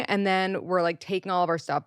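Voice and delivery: normal talking voice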